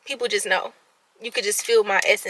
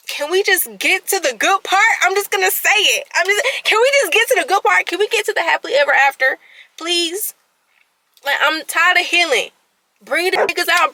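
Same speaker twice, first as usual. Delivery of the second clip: raised, louder, fed-up outburst; impatient pleading; 5 semitones higher, 5 dB louder than usual. Real, not acted. Woman venting to viewers that she's tired of healing, impatient. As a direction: plead urgently, higher and insistent.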